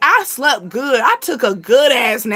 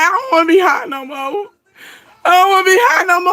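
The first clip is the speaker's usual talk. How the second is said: higher, fast, whiny half-sobbing complaint; play-whimper that you want off the high; mock crying plea; 5 semitones higher, faster than usual. Retelling her edible trip, whining she's too high. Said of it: "I cried".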